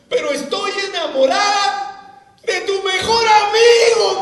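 loud, slow, high-pitched retold exclamation; a dramatic retelling, cried out high and loud; retold cry. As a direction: belt out her confession slowly. Retold girlfriend confessing to him.

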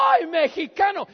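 loud, high, gleeful cry; overjoyed greeting. Retold or imitated. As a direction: imitate a thrilled host greeting a friend. Retold joyful greeting before a hug.

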